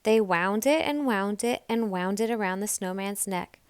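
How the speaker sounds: ordinary delivery, nothing marked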